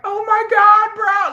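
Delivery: mock fangirl. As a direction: gush it like a starstruck fan. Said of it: "they were like".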